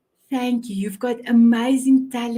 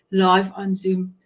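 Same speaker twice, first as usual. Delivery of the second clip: usual speaking voice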